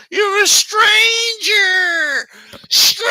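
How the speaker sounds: put-on high screaming wail of a scared kid. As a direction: shriek it like a panicked child. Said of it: "and he's like".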